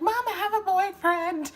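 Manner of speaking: loud, high-pitched squeal; excited yell. Retold or imitated. Daughter running into the house to mom, excited yell. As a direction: shout the big news in giddy excitement.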